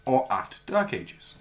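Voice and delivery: ordinary talk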